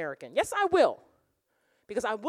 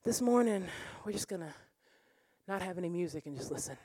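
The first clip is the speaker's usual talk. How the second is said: mock pastor; 5 semitones lower, 4 dB quieter than usual. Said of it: "me as a worship pastor being like".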